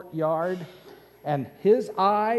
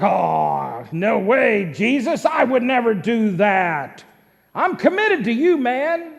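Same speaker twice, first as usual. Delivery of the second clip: high, loud, indignant protest; 4 semitones higher, 7 dB louder than usual. Acted. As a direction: Peter insisting, raising his voice high. Peter protests loudly.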